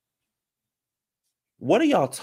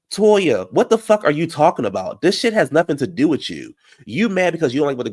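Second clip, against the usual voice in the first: louder and higher, cussing Toya out; angry outburst; 4 semitones higher, 5 dB louder than usual. Real, not acted. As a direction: go off on her at full volume. Ranting at Toya on show.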